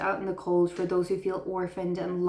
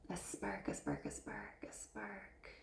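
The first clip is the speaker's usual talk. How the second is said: fading to a whisper; 17 dB quieter than usual. Acted. Repeating word, fading into hush.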